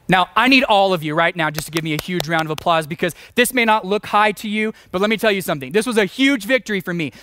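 usual speaking voice

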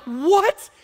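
high, loud disbelieving what; mock the wife's shock; wife hearing the news, surprised. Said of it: "she was like".

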